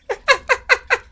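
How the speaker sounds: high-pitched real burst of laughter; helpless, high staccato laugh. Real, not acted. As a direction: crack up laughing. Laughs at a viewer's message.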